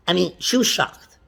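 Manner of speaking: normal talking voice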